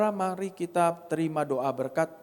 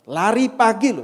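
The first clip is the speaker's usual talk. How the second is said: excited; 7 semitones higher, 9 dB louder than usual. Real, not acted. Amazed at morning run.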